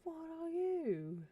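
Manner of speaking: high, slow, stretched question; mock accuser. Player confronting the strange NPC, high drawn-out mock accusation. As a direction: draw it out high, playfully appalled.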